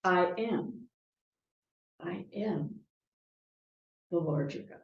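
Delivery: "I am", a long pause, then "the Lord your God"; pause after am. Shown as a demonstration. Preacher putting the pause after am, softly. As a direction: let "I am" stand alone before going on. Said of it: "the pause after the word "am".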